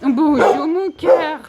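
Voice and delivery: high, sing-song coo to each dog. Real, not acted. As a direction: coax the dogs like babies, high and bright. Greets more dogs in kennels.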